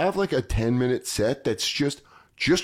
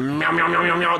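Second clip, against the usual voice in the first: mock meowing; 5 semitones higher than usual. Mocking French comic babble.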